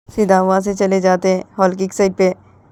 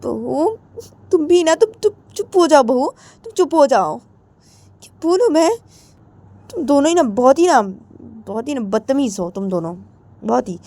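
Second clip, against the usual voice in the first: exasperated scolding; 6 semitones higher than usual. Acted. Krishna scolding her bahu, high and exasperated. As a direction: tell her to shut up, exasperated.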